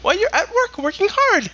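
high squeaky Jar Jar Binks, gloating. Pretend. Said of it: "redo Jar Jar Binks".

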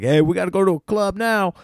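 lightly voiced eager party guy; mock club-goer. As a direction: hype up the group for the club. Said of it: "the guy who's like".

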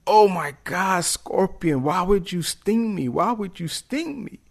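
high, anguished mock wail; betrayed frog. Stung frog asks scorpion why, high dismayed cry.

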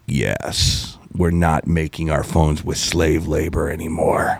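low, breathy start, then low and soft; hushed deep corporate voice; deep whisper. Retold or imitated. Host voicing Apple quitting slave labor, low and hushed. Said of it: "we had Apple going".

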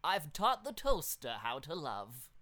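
put-on loud, slow, doddering old scientist; Professor Farnsworth voice. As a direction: announce it like a dotty old professor. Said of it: "the professor from Futurama".

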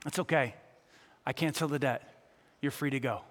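king pardoning a kneeling debtor; merciful king. Acted. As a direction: forgive him kindly and quietly. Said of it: "the king says".

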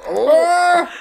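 loud, high-pitched "oh!" of horror; recoiling yelp; horrified cry. Acted. Acting out viewer's horrified reaction.

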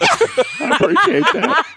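high, slow squeaky thanks; mock cracking voice with laughter in it; imitated voice crack. Joking thanks after the presentation story, voice cracking high. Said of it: "My voice was cracking".

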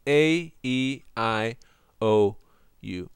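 "A, E, I, O, U" as separate English letter names, paused between; repeated vowel demonstration. Shown as a demonstration. Teacher repeats the vowels for students, deliberate spaced delivery. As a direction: punch out "A, E, I, O, U" one by one. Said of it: "I repeat".

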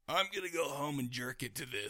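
a sly private thought; inner thought. Retold or imitated. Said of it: "And I'm sitting here thinking".

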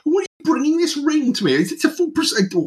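pompous diner pitched high in fury; outraged half-elf host. Acted. Portly half-elf NPC berates visitor.

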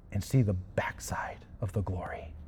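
breathy hush, barely voiced; whisper. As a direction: lean in and whisper the promise. God's promise to Moses.

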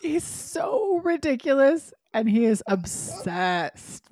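high, slow exasperated cry. Real, not acted. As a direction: stretch it out in disbelief. Exasperated about Victor.

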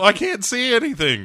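high, loud mock protest; complaining blind guy. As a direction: wail it as the hapless blind cleaner. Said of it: "make the blind guy do it".

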